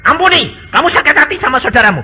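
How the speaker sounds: urging forgiveness. Retold or imitated. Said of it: "Saya bilang".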